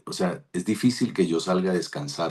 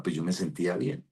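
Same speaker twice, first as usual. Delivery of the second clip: ordinary talk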